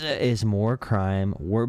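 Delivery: drawn out, breaking off; slow and smug, cut off at the end; slow mock question. Streamer mocking the debater, slow and cut off. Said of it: "the Patrick Wallet meme".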